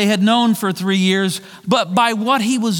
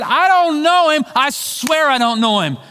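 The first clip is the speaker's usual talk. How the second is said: higher, louder sworn denial; desperate denial; 7 semitones higher, 3 dB louder than usual. Retold or imitated. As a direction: swear it, cornered and pushing back. Peter swears denial.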